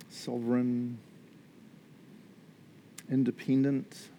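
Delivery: hushed, unhurried prayer voice; gentle spoken prayer, mostly hushed; soft prayer. Acted. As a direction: pray softly over the congregation. Pastor leads a quiet prayer.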